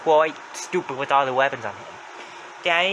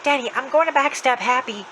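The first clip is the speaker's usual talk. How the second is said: high-pitched character voice; Jenny's voice; 10 semitones higher than usual. Retold or imitated. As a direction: say it in a raised, put-on voice. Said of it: "Jenny said".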